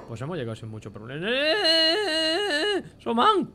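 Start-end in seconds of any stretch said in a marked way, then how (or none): 1.3-3.5 high, loud cry of alarm; startled scream. Real, not acted. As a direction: yelp loudly as if suddenly ambushed. Startled by sudden attack in game.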